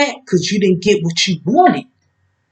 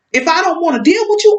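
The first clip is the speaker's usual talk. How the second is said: ordinary delivery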